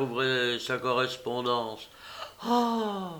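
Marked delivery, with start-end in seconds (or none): 2.4-3.1 sudden high gasped Ah; a startled young woman cries out; surprised cry. A narrator's reading. La fille, lisant la lettre de deuil, s'écrie, surprise. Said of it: "s'écria-t-elle".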